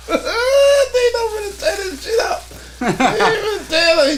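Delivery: very high, drawn-out laughing shout; laughing alarm. Real, not acted. Watching the dog wreck the setup, laughing alarm. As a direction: yell it through real laughter, voice way up.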